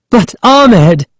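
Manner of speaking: high-pitched protest; Sari crying out. A narrator's reading. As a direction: cry out to him in a high voice. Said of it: "Sari cried".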